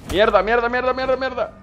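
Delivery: rapid, rising panicked swearing; panicked cursing. Real, not acted. About to lose.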